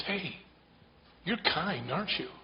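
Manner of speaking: lightly voiced lady, up high; woman's voice. Woman tells him he's kind.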